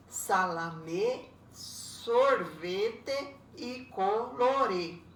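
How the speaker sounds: "Salame, sorvete e colore" slowly, each word in three beats; syllable by syllable. Shown as a demonstration. Teacher recapping three-syllable words, "Salame, sorvete e colore" drawn out by syllable. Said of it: "essas têm três sílabas".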